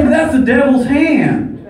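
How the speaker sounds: high, fervent preacher voice; mock sermon, rising to a roar; preaching. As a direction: thunder about the devil's hand. Slides back into preacher mode.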